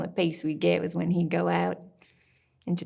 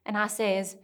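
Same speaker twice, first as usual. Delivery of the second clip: ordinary delivery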